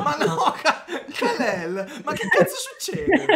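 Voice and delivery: high laughing disbelief. Real, not acted. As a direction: squeal it while cracking up. Bursting into laughter.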